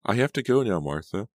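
high-pitched, agitated refusal; insistent. A narrator's reading. Laura trying to end the call with Martha, insistent. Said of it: "she insisted".

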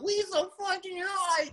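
high, drawn-out team hype; hyped up. Acted. Player acting out the team's excitement, hyped. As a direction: whoop it up like a pumped player.

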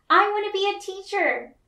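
high, eager little classmate; young child voice. Acted. A classmate answers Miss Bell, high and eager. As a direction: pipe up like a small kid in class. Classmates share dreams in turn.

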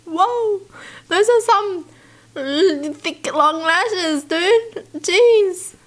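high-pitched, drawn-out astonishment; amazed. Real, not acted. Reacting to someone's huge lashes, amazed.

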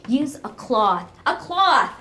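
slow, loud, then a shrieking high shout; the old mother rising to a shriek; furious scolding. Acted. Bedridden mother scolds daughter.

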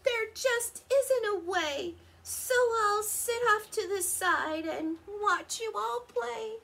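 high, plaintive character voice; sighing resignation. A narrator's reading. Ricky offers to sit out, high resigned lament. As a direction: give up with a plaintive lilt. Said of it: "Ricky sighed".

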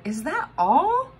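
high, slow, let-down question; unimpressed stranger. A narrator's reading. Stranger at the geyser, slow and let down. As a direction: drag out the disappointment.

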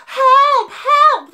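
very high, very loud panicked cries; frightened fishermen yelling Help; terrified screams. Acted. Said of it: "they screamed".

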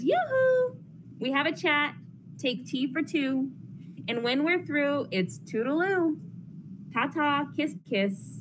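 high, sing-song auntie calling out yoo-hoo; chirpy Auntie Lou. Acted. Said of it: "My Auntie Lou, she says".

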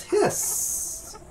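long sibilant "hisss"; snake hiss. Pretend. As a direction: draw out the s like a snake. Quiz on snake sound.

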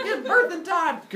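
high, loud comic strain; hammed-up high-pitched yell; straining birth yell. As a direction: push it out as if in labor. Imitates Zeus straining to give birth.

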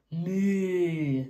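long drawn-out "moo"; sustained picture-book cow noise. Acted.

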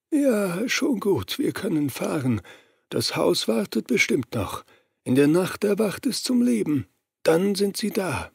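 a strange, otherworldly high voice, hushed; possessed man speaking high and soft; Gary Ross voice. Acted.

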